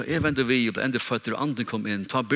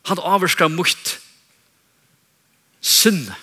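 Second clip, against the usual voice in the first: raised pitch, loud punched key words; emphatic preaching; 5 semitones higher, 5 dB louder than usual. Real, not acted. Preacher explaining to congregation how God's Spirit works, emphatic preaching. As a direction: drive the teaching home forcefully.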